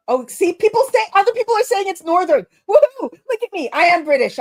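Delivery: giddy victory celebration; gleeful excitement. Real, not acted. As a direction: cheer like chat just proved you right. Chat confirms her accent guess.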